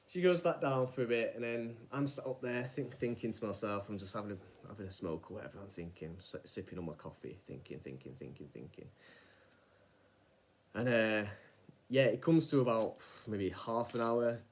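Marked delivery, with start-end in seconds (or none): 4.0-8.9 low, fading murmur; lost in thought. Retold or imitated. Alone with his coffee, sinking into quiet thought. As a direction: let it sink as you ponder.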